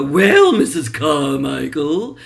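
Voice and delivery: a loud "Well", then a slow, pompous "Missus Carmichael"; Gail Gordon impression. Lucy's boss addressing Mrs Carmichael, loud and pompous. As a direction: boom it out like a stuffy sitcom boss. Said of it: "He'd go".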